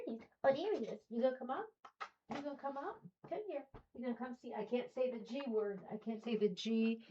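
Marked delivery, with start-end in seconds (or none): 0.0-4.5 high, soft coaxing voice to the dog. Real, not acted. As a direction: sweet-talk the puppy up onto your lap. Calling the dog up to her.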